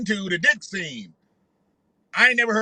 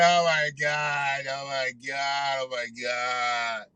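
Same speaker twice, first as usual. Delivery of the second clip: mock lovestruck sigh; 7 semitones lower, 5 dB quieter, slower than usual. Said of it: "He said".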